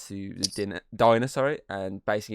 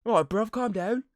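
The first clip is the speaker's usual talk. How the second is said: high, loud jumpy geezer calming someone; placating cockney bloke; 12 semitones higher, 8 dB louder than usual. Retold or imitated. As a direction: nervously talk a shouter down, mate-style. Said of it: "And he's like".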